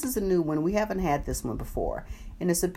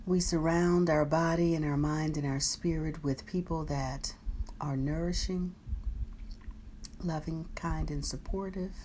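guided meditation; 7 dB quieter than usual. Acted. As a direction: guide them gently through the body. Guided body scan meditation.

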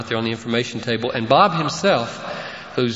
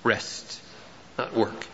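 near-whispered and much softer; hushed aside; 2 semitones lower, 9 dB quieter, much slower than usual. Acted. Pastor to his congregation, a hushed aside on the verse. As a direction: let it drop to a hush.

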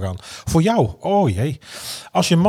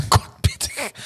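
laughing repeat; 10 semitones higher, much slower than usual. Real, not acted.

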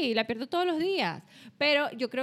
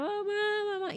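niño llorando; 9 semitones higher, slower than usual. Retold or imitated. Son crying at bedtime.

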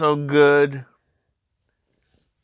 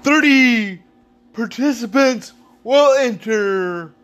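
booming wrestling announcer promo; big ring announcer hype voice; dramatic reality show hype; 8 semitones higher, slower than usual. Acted. Wrestling event promo intro.

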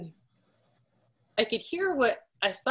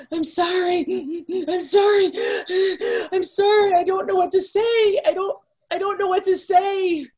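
much higher, tearful and pleading, voice cracking; retold sobbing apology; 10 semitones higher, 4 dB louder than usual. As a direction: imitate a woman choking on tears as she breaks the news. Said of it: "she was crying so hard".

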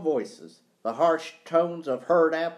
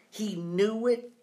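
slow, higher-pitched questioning echo; surprised; 7 semitones higher than usual. A narrator's reading. Boy learns dad knew the combination, surprised. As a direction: repeat it slowly in surprise, voice lifted. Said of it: "repeated Joe, surprised".

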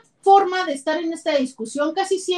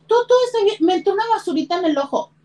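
high, forced bright voice, hiding tears; a mother pretending all is fine while crying; tearful mom chirping that everything's okay; fake cheerful mom; 9 semitones higher, much faster than usual. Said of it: "la lágrima saliéndote y tú".

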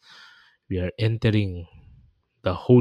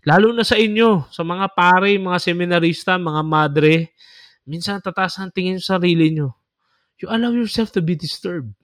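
scolding rebuke; 7 semitones higher than usual. Real, not acted. Scolding priests and nuns directly.

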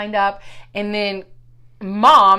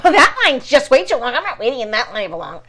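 imitating Mom; 3 semitones higher, 3 dB louder than usual. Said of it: "And Mom's like".

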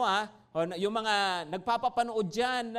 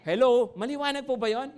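ordinary talk, nothing marked

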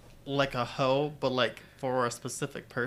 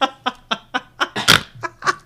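shrieking laugh; high burst of laughter; 11 semitones higher, 11 dB louder than usual. Real, not acted. Cohost laughing at the teasing, loud laugh.

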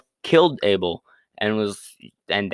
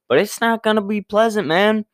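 God's mock warning; 11 semitones higher, 3 dB louder than usual. God curses Cain, voiced.

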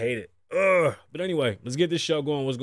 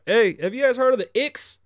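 hyped; 10 semitones higher, 5 dB louder than usual. Real, not acted. Hyped rant to viewers.

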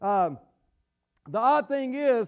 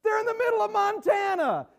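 much higher, exasperated disbelief; incredulous outburst; 10 semitones higher than usual. Retold or imitated. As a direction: act baffled at the movie. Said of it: "I'm like".

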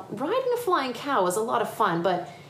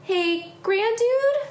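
put-on high-pitched child calling for attention; child character; 3 dB louder, much slower than usual. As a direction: pipe up as a little kid. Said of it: "said Em".

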